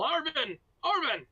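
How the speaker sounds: high-pitched, loud call into the distance; yelling a name. Acted. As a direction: holler for a comrade, in character. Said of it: "you can hear them yell".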